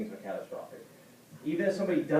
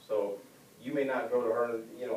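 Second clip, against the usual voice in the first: ordinary talk, nothing marked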